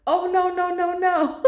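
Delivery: high, laughing "oh no no"; laughing panic. Retold or imitated. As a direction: giggle through the words in mock panic. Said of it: "I'm like".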